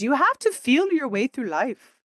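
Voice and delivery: a hint of Deepak's gentle accent; Deepak Chopra accent. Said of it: "in his cute little accent".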